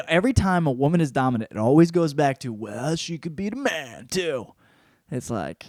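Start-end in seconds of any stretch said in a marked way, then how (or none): 2.5-4.5 slow, drawn-out mock voice; mocking quote. Mimicking people who judge dominant women, slow mocking voice. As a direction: drag it out mockingly.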